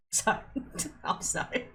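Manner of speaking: high, breathless laughing apology. Real, not acted. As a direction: apologise through helpless giggles. Apologising while overcome, laughing.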